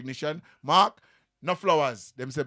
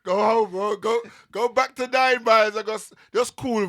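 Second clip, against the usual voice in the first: loud teasing shout, higher; mock shout; 4 semitones higher, 6 dB louder than usual. Guest loudly teases the rival crew to go home. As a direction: call out go home like a rowdy taunt.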